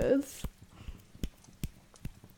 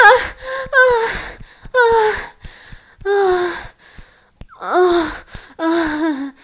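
high, loud ecstatic cries; orgasm cries; 8 semitones higher, 10 dB louder than usual. Acted. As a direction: peak of pleasure, crying out. Acted orgasm.

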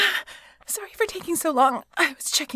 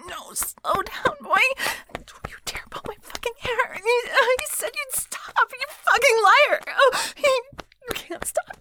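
high pleading cry; 11 semitones higher, 4 dB louder than usual. Acted. Pleading, crying out.

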